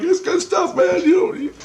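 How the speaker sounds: high, hurried protest; defensive pleading. Retold or imitated. Dreamer defending his beer to Jesus, high and pleading. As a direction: plead the beer's case in a squeaky voice. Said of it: "And I'm like".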